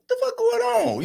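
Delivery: high-pitched retold bewilderment; baffled outburst. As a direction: act out being totally baffled, voice shooting up. Acting out his bewilderment at daughter.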